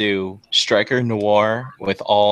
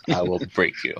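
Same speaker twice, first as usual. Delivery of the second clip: lightly voiced Drago, low and quiet; hint of a menacing movie villain; low, understated threat; 3 semitones lower, 6 dB quieter than usual. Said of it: "the Drago voice".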